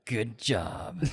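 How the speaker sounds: trailer voice. Pretend. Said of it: "stuck in my trailer voice".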